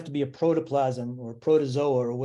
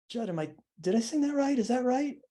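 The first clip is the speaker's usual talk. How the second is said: light, higher voice, mildly unsure; 9 semitones higher than usual. Retold or imitated. Singer asking songwriter for approval, lightly voiced. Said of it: "she would go".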